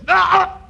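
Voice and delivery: high loud yelp; startled yelp. Acted. Boss yelping as egg is cracked on his head.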